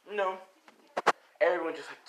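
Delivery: ordinary delivery, nothing marked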